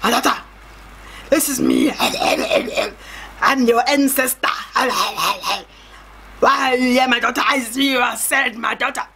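imitated ancestor with a weird accent; mock ghostly elder voice; ancestor spirit voice. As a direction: put on an odd-accented spirit addressing a child. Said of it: "so my ancestor".

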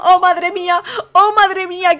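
lightly voiced dismayed contestant; a touch of fake despair; mock upset contestant. Commentator voices contestant 67 lamenting her friend, lightly voiced. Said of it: "ya está diciendo".

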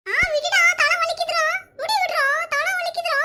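put-on high-pitched child's wail of pain. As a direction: cry out to be let go. Child's hair pulled in a fight.

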